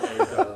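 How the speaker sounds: short high real laugh; a quick bright laugh at the joke. Real, not acted. Laughs at the word pero. (risa corta y aguda)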